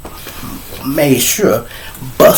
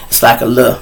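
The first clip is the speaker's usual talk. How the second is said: ordinary delivery, nothing marked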